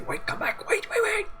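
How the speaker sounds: hushed, urgent call to stop; urgent whisper. Acted. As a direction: whisper it urgently, don't be heard. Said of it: "you hear the Master Alphonse say".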